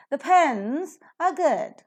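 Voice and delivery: the and are reduced, pens aspirated; slow model sentence, pens and good on top; stress on pens and good. Shown as a demonstration. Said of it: "you could listen to pens and good at the top level".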